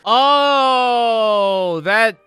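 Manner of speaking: loud, high startled oh; reeling at what she just read; stunned exclamation; shocked gasp. Real, not acted. Shocked by the text.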